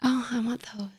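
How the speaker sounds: eager whisper. Real, not acted. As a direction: breathe it out in a hush. Reacting to High School Musical.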